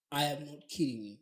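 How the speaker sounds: ordinary delivery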